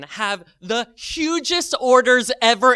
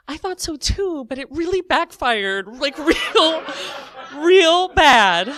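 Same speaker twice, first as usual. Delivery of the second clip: high, hammed-up whimper; mock tearful; 8 semitones higher, 5 dB louder, slower than usual. Teller admitting to cashier it backfired, mock-tearful high voice. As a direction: let it rise into a comic cry.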